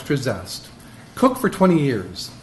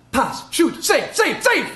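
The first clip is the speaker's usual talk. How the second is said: excited; 13 semitones higher, slower than usual. Acted. Goalie living the game play.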